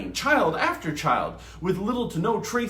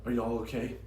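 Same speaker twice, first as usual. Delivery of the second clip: quiet concern; 6 semitones lower, 7 dB quieter, faster than usual. A narrator's reading. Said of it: "Mad asked her siblings".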